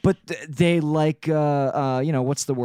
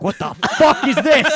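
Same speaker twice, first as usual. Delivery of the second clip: shocked outburst; 8 semitones higher, 6 dB louder than usual. Retold or imitated. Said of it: "You're like".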